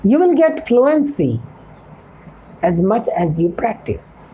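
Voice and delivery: slow English. Shown as a demonstration. Teacher slowly repeats advice in English.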